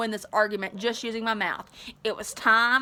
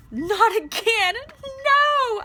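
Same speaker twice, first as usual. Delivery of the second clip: high, slow, pleading whimper; horror-struck cry of no; 12 semitones higher, 4 dB louder, much slower than usual. Acted. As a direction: narrator voicing Daryl's horrified whimper over Cap. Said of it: "I whimpered".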